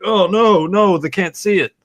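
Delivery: high, loud fake panic; mock panic. Imagining friend panicking as his mask is ripped off, mock panic high and loud. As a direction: squeal it like a wrestler losing his mask. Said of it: "you're just like".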